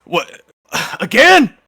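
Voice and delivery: loud, high exasperated sigh; fed up with the same fight; guard's weary outburst. Acted. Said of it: "the guy in the middle is like".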